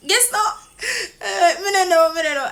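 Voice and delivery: high, loud playful imitation of the star; mock patois reply. Fan imitating the star's playful answer, high and loud. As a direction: tease it in a lighter patois.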